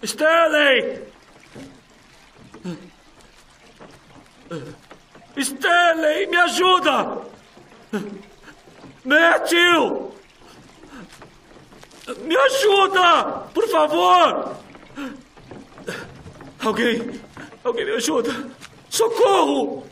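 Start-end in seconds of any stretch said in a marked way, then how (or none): 0.0-14.6 desperate loud shouting; calling for help. Acted. 18.9-19.9 desperate loud cry; screaming for help. Acted. Trapped, calling for help.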